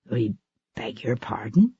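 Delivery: whispered, puzzled question; confused whisper. Acted. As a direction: breathe it out in confusion. Confused visitor, hushed question.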